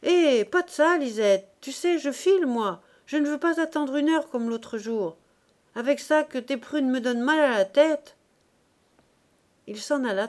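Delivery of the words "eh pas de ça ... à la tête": loud, higher-pitched grumbling call; acted man yelling in protest; coarse-voiced shout. A narrator's reading. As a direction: shout a complaint after someone walking off. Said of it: "lui cria de sa voix grasse".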